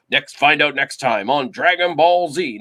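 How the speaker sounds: loud, dramatic next-episode announcer; anime announcer voice. Retold or imitated. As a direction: boom it out like a TV narrator. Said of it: "Now I sound like the announcer from".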